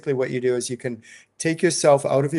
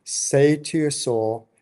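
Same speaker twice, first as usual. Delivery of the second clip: ordinary talk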